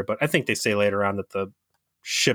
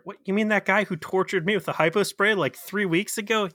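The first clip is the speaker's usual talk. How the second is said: high-pitched incredulous objection; mock-incredulous higher voice; 9 semitones higher than usual. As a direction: question it in disbelief, pitched up. Acted objection to being sent the doctor.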